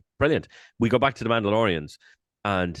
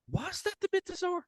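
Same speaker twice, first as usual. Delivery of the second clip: very high, soft puzzled squeak; incredulous question; 8 dB quieter than usual. Retold or imitated. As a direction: ask it in high-pitched retold disbelief. Retelling puzzled reaction to creature.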